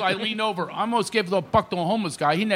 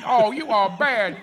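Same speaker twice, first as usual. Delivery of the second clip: higher, slowed teasing repeat; Magic impression; 5 semitones higher, 4 dB louder than usual. Comic imitating his co-host repeating the tease. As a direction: draw it out playfully. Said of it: "He goes".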